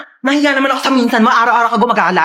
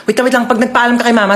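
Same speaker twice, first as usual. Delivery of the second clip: normal talking voice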